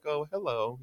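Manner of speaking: lightly voiced little guy, high and soft; timid little guy. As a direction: gently imitate a meek little character. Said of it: "a very little guy".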